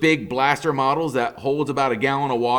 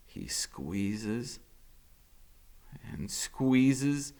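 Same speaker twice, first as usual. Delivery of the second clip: fading narration; 10 dB quieter, much slower than usual. A narrator's reading. Strangling, narration fades.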